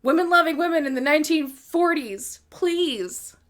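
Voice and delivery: loud, high swooning approval; hayran tepkisi. Acted. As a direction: gush it like a delighted fan, loud and emphatic. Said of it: "You're just like".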